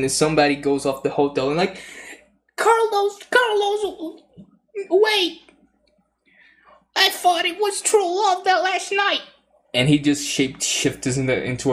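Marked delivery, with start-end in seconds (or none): 2.6-9.2 high falsetto pleading Carlos to wait; heartbroken woman voice. Acted. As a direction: cry after him in a high, far falsetto like a jilted lover.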